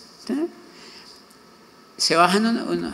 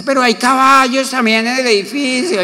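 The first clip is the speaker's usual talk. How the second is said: higher, louder put-on neighbour voice; neighbour at the meeting; 8 semitones higher, 4 dB louder than usual. Neighbour at the assembly raising the horse issue, in a higher mock voice. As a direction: play the scandalised neighbour. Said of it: "y dice".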